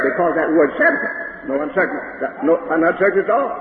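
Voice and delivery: usual speaking voice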